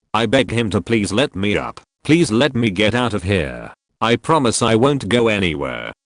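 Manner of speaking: ordinary delivery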